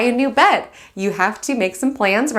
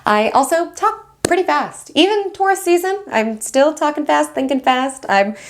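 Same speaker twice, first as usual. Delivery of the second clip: higher-pitched, giddy self-aware confession; excited; 6 semitones higher than usual. Real, not acted. Astrologer admitting to viewers she talks fast, excited. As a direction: chatter excitedly, pitch lifted well up. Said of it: "sometimes I just get excited".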